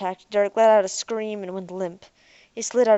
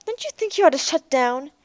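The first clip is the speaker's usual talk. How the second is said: a higher, louder pressing question; forceful; 7 semitones higher, 6 dB louder than usual. Acted. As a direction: push the robot hard to shut down. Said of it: "Ariel said forcefully".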